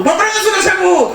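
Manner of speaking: high, eager thanks through laughter; overjoyed thanks to the pastor, pitched high; excited thanks. Retold or imitated. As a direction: gush at the pastor. Repeating the joyful news.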